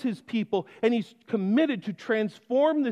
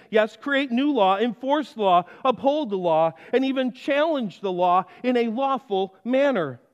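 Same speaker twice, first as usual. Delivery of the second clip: usual speaking voice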